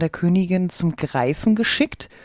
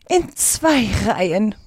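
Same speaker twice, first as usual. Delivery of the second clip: drawn-out, mildly raised call; a storyteller's slow exclamation; 3 semitones higher than usual. A narrator's reading. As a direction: call it out slowly, stretching each word. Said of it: "rief die falsche Schildkröte".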